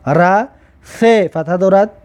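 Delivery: ordinary delivery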